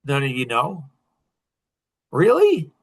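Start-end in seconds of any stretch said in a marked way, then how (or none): 2.1-2.7 high, loud incredulous Really?; mock disbelief. Real, not acted. Host stunned the audience has no answer, incredulous. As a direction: act stunned that nobody answered.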